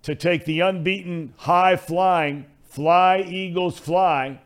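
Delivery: big booming sportscaster call; drawn out like a broadcast intro; announcer voice. Acted. Announcer-style game call.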